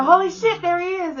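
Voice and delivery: high, loud exclamation; retold thrilled outburst; excited spotting. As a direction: call out as you spot your kid in the crowd. Spotting son at graduation, retold.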